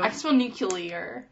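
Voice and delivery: southern twang on "nuclear", quiet; soft laughing southern "nuclear"; southern accent. Real, not acted. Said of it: "southern accent when you say nuclear".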